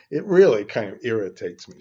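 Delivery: loud, quick burst of irritation; irritated. Real, not acted. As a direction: let the annoyance spill out. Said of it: "I'm getting a little emotional".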